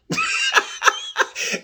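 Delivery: high, airy burst of laughter; gleeful laugh. Real, not acted. Host laughing about starting to cuss again, gleeful laugh. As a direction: laugh at your own cheeky plan.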